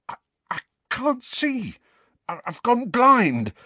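very high, stammering panic; high, frightened stutter; terrified. A narrator's reading. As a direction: wail it in blind panic. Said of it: "Dudley's terrified voice".

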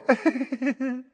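high, tinkling false laugh; simpering witch's cold little laugh; silvery laugh. A narrator's reading. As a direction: laugh sweetly with no warmth. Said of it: "She gave a silvery laugh".

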